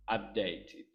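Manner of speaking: usual speaking voice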